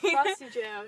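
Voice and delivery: Frosty said loud and firm; correcting: Frosty, not frosted; stress on Frosty. Shown as a demonstration. Friend correcting the drink name, loud and firm. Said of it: "not a frosty".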